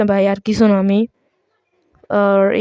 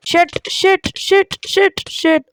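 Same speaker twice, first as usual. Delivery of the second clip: high-pitched panicked cursing; real dismay, the words tumbling out high; 8 semitones higher, slower than usual. Real, not acted. Gamer who just threw away his items, high panicked cursing. Lost all items by mistake.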